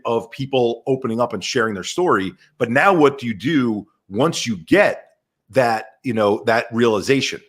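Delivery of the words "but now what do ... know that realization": ordinary talk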